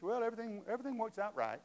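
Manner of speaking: high-pitched mock reassurance; glib platitude. Preacher imitating a saying he rejects, high and glib.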